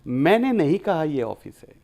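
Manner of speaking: मैंने pressed hard and loud, rest falling away; मैंने stressed to shift the blame. Shown as a demonstration. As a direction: lean on मैंने, as if someone else said it. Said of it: "मैंने कहाँ जोर दिया? पहले शब्द पे।".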